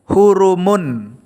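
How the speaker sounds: hurumun, slow, every syllable clear; hurumun said carefully. Shown as a demonstration. Teacher showing the joined reading hurumun to a pupil, slowly. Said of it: "Contoh lagi".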